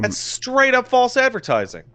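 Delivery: high-pitched indignant protest; real exasperated accusation; indignant complaint. Real, not acted. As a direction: pitch it way up in outrage. Angry complaint about misleading ad.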